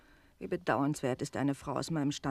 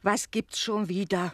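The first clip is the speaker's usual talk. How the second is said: slow, exasperated call; annoyed; 4 semitones higher, 5 dB louder than usual. Acted. Landlady fed up with interruptions, exasperated. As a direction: let the irritation drag out.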